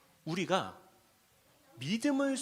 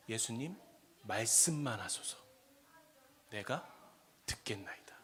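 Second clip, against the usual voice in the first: humble plea; 7 semitones lower, 6 dB quieter than usual. Acted. Centurion's humble plea quoted.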